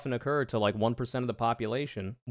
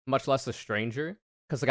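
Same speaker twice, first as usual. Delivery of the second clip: usual speaking voice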